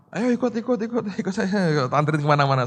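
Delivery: high-pitched chattering come-along; mock eager invitation. Pastor mocking himself inviting people along, playfully eager. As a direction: playfully mimic someone rounding up passengers.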